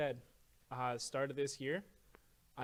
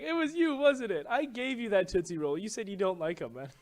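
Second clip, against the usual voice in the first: high, loud amused boss voice; teasing accusation, pitched up; imitating supervisor; 7 semitones higher, 9 dB louder than usual. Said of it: "And he's like".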